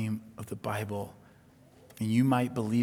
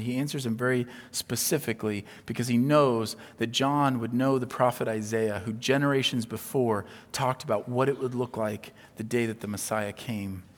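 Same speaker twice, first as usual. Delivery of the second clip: usual speaking voice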